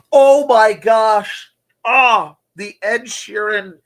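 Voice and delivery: drawn-out delighted gasp; excited. Real, not acted. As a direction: stretch it out, thrilled. Delighted reaction to mashup.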